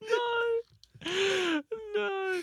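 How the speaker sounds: very high, squeaky laughing wail; helpless laughter. Real, not acted. Co-host losing it over a bad mascot, helplessly laughing.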